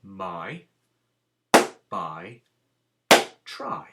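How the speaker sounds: MY, BY, TRY as single separate beats; one clapped beat per word. Shown as a demonstration. Teacher showing each word is one beat, separate single-syllable words. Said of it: "there is one beat of sound".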